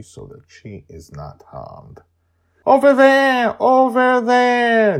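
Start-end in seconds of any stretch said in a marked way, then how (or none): 2.7-5.0 high, loud excited shout. Acted. Joy shouting to Charlie from the condo, high and loud. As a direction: call out from the flying condo. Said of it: "Joy begins to shout".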